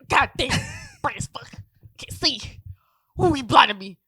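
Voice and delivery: loud, high hammed-up complaint; mock exasperation. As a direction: exclaim as if dazzled, laughing partway. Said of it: "You literally said".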